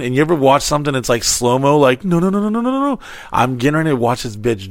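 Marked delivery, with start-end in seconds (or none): 2.0-3.0 climbing higher and higher with each no; horrified no's rising sharply in pitch; panicked no's. Retold or imitated. Watching crash about to happen.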